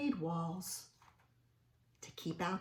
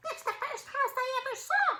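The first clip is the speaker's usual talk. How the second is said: very high, squeaky little mouse; field mouse voice; 3 dB louder than usual. Acted. Field mouse marvelling at Stanley's house, squeaky. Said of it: "said a field mouse".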